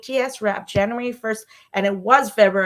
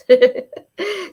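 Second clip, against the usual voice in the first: breathy whispered laugh; 7 semitones higher, 8 dB louder than usual. Real, not acted. Breathless laugh after excited rant.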